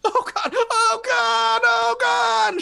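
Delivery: high panicked shrieks; freaking out. Acted. GM voicing the NPC, terrified and screaming. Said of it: "She starts freaking out".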